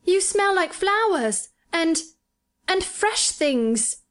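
put-on high, slow, delighted boy's voice; a sickly boy crying out in joy; joyous boy. A narrator's reading. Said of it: "he cried out quite joyously".

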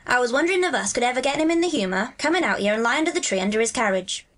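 broad Yorkshire dialect, lilting; Dickon, friendly country lad. Acted. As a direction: chat like a Yorkshire moor boy. Voices Dickon in dialogue.

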